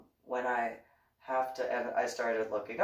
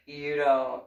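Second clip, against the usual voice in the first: ordinary talk, nothing marked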